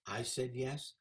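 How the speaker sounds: normal talking voice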